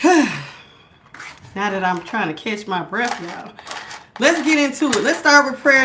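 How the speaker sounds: ordinary talk, nothing marked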